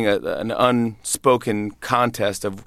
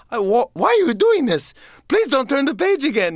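panicked Grover. Retold or imitated. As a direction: plead high and frantic like the furry monster. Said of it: "And he's like".

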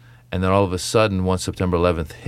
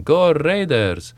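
higher, slow, stretched-out cheer; dad's voice; 8 semitones higher, much slower than usual. Retold or imitated. Comic imitating his father, higher and slow. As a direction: drag it out like his dad. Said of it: "And my dad's going".

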